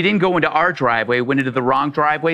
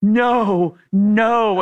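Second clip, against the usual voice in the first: higher-pitched, drawn-out mock dismay; 5 semitones higher, much slower than usual. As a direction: imitate a distraught woman wailing no. Woman realises she is despised.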